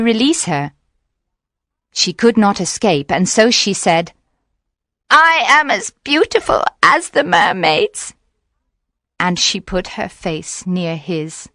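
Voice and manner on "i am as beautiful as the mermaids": high witch voice; coaxing witch. A narrator's reading. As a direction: coax your captor in a high voice. Witch tempts the fisherman.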